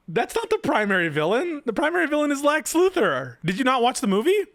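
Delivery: high-pitched, exasperated disbelief; incredulous challenge. Real, not acted. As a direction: challenge the writer with pitch soaring. Incredulous challenge to the article's writer.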